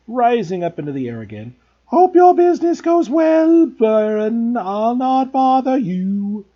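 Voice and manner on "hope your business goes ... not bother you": high loud grovelling poltergeist; Peeves' voice. A narrator's reading. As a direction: fawn eagerly like a ghost.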